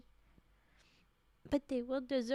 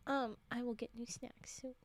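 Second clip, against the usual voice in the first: whispered promise; 2 semitones lower, 5 dB quieter than usual. Real, not acted. Whispered aside.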